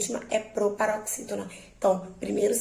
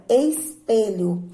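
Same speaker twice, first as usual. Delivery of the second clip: espelho slowed, with PE stressed; stress on the middle syllable; 3 dB louder, much slower than usual. Shown as a demonstration. Teacher showing which syllable is strongest, stressed PE in espelho. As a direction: draw out espelho, leaning on PE. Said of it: "o P é o mais forte".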